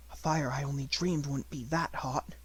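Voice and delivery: ordinary delivery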